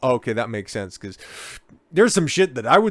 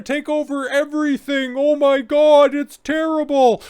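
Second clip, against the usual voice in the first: mock dismay; 4 dB louder than usual. Mocking the pundits' panic with a high mock wail. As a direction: ham up the horror, pitched way up.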